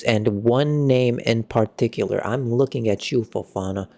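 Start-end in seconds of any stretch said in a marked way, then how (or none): none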